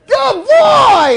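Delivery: loud, very high squeal of praise; really excited. Acted. Owner praising a dog that just sat, excited shout. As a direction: gush it like a thrilled owner. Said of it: "now you're really excited".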